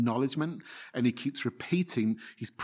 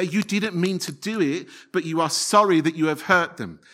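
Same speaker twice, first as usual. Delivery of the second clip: ordinary talk, nothing marked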